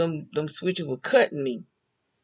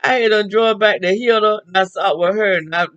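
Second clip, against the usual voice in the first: excited loud retelling; 5 semitones higher, 8 dB louder than usual. Retold or imitated. Excited retelling near fight.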